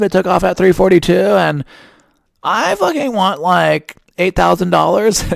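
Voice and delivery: mock a petty complainer demanding a fortune; entitled customer. Entitled flyer complaining about a late flight, in a mock whiny high voice. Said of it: "I'm not one of those people that's like".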